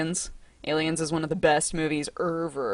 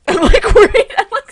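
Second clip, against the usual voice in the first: nervous laugh; 14 semitones higher, 13 dB louder than usual. Real, not acted. Laughing nervously about chat freezing.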